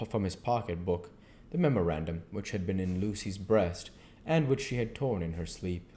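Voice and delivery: ordinary delivery, nothing marked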